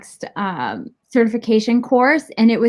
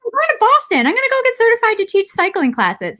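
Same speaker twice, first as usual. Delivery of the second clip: high, bright retold announcement; cheerful announcement; 11 semitones higher, faster than usual. Telling her dad her trip plan, light and high. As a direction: chirp the news like it's no big deal.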